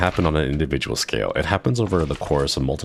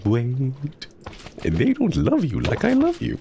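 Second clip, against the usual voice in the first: much higher, squeaky put-on Heimerdinger voice, full commitment; meme Heimerdinger line; 9 semitones higher than usual. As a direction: go all in on the shrieking meme version. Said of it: "And that meme".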